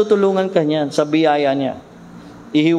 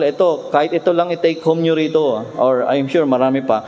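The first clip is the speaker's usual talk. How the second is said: ordinary talk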